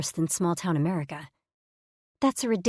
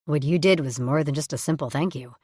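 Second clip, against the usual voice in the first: usual speaking voice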